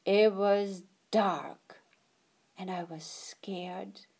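slow scared whisper; hushed, frightened whisper. A narrator's reading. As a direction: breathe it out slowly, afraid of the dark. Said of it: "whispered narration".

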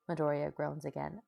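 ordinary delivery

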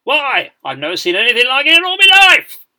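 outraged bellow climbing to a high held peak; rising-cadence exclamation. A narrator's reading. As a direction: start loud and swoop up to the final word. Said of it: "usually delivered in a rising cadence".

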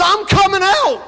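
high eager shout; excited shout. Retold or imitated. Peter shouts from the boat to Jesus, thrilled. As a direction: call it out, bursting to go.

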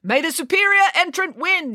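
high, ringing starter's cry; yelling. Acted. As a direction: proclaim it at a high pitch. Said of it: "yelled the vice principal".

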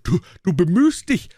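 high, stammered angry outburst; furious cry. A narrator's reading. Tyrant king rages at his dwarf, furious high cry. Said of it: "schrie der Tyrann wütend".

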